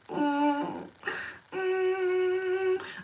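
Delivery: long, drawn-out "mm" moan; imitated moaning. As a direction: hum a high, held moan as if in pain. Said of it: "Like, I'm moaning".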